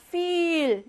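German viel, long ee; long vowel. Shown as a demonstration. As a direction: feel with a long drawn i. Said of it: "요 때는 길게 발음해요".

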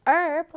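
American "herb" with no H at the start; silent H. Shown as a demonstration. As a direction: drop the H and begin "herb" on its vowel. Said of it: "미국식 영어는 H를 발음하지 않는다". ("herb"를 H 소리 없이 미국식으로 발음)

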